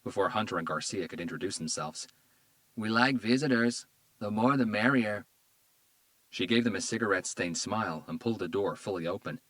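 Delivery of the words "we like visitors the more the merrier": lightly voiced flirty woman with a Spanish accent; dopey flirty woman, Spanish accent. A narrator's reading. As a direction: woo them in, Spanish accent.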